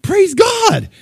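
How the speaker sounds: high, loud burst of praise; exultant cry of praise to God; joyful praise. Retold or imitated. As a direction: let it ring out in relief. Joyful retold realization.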